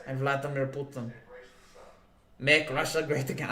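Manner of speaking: put-on unconvincing low Russian accent; Vladimir Putin. Streamer admitting a weak impression to chat, amateur Russian accent. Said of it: "Can't do a great Russian voice".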